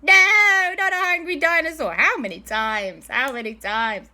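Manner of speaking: loud high cry of dismay, easing down; hammed-up dismay at yet another danger; exasperated wail. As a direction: wail it in mock despair. Group wails about another dinosaur.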